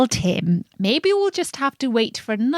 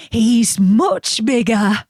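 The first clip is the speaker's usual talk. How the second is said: slower, moderate goat voice; middle goat voice; 2 semitones higher, 3 dB louder, slower than usual. A narrator's reading. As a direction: say it plainly, only lightly voiced. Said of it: "who hadn't such a small voice".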